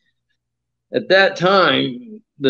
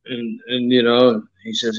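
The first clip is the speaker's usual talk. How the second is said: ordinary talk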